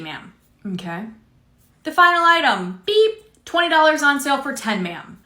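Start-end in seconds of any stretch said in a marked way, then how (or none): 2.8-3.4 high mock scanner beep. Cashier voicing scanner.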